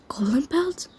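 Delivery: lightly voiced inner worry; worried thought. A narrator's reading. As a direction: think it aloud as an uneasy question. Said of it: "Talon thought with worry".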